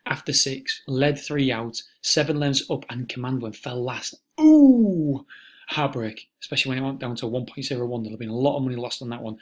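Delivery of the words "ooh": pained groan. Real, not acted. Horse fell at last fence.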